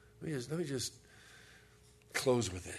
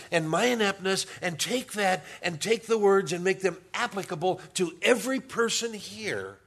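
raised, louder preaching at full force; 6 semitones higher, 5 dB louder than usual. Acted. Pastor to his church, preaching with fervour. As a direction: lift the congregation, preaching hard.

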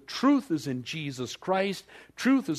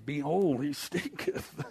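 mock disgust; slower than usual. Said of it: "others were standing around saying".

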